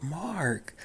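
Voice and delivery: high, soft, chummy receptionist voice; overfamiliar receptionist. Retold or imitated. Receptionist greeting a caller like an old friend, overfamiliar. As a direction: say the name softly and high, like an old acquaintance. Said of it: "talking to me as if she had been knowing me for years".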